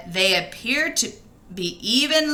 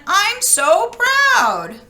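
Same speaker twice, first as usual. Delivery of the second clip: high, slow and loud with pride; proud granny; 10 semitones higher, 5 dB louder, much slower than usual. Acted. Gran telling Red, slow and high with pride. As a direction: beam with pride, drawn out.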